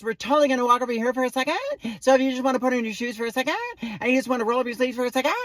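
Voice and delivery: put-on fast, high Valley Girl uptalk; quick Valley Girl chatter rising at the end. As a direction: rattle it off like a Valley Girl, ending in a question. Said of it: "like, a Valley Girl thing".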